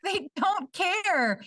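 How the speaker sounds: high and slow; mock dismay.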